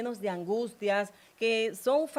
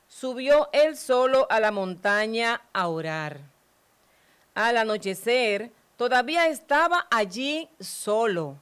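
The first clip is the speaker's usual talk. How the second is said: louder, measured Gospel proclamation; solemn louder scripture reading; 5 dB louder than usual. Acted. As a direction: proclaim it to the congregation.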